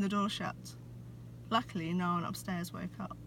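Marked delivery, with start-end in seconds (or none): none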